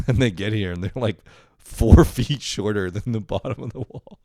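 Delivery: real laughter bubbling through the words; laughing while talking. Real, not acted. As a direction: pitch climbing as laughter breaks in. Cracking up at girlfriend's measuring blunder.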